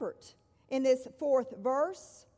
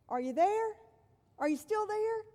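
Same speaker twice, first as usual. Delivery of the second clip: put-on little girl's voice; 7 semitones higher than usual. Little girl calls to parents.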